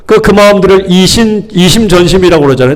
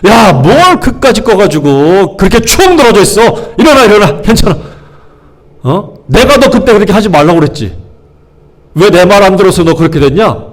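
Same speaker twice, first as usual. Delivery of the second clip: mock lecture, pitched higher; scolding; 4 semitones higher than usual. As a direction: scold them impatiently, as if attacking. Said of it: "막 혼내는 거예요". (다짜고짜 혼내는 훈계조)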